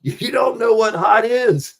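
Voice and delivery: high, loud, slow mock yell; mock drill sergeant rant; imitating Joey. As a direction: bellow like a scornful veteran. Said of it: "I'll never forget him saying".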